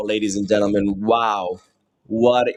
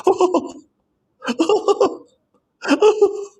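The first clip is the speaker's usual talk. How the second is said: ecstatic high-pitched screams of joy; long goal yell; slower than usual. Real, not acted. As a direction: shriek in disbelief at the goal. Wonder goal just scored.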